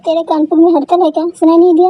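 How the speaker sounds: snapping at friends on the phone; annoyed scolding. Acted. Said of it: "इतना गुस्सा क्यों कर रही है".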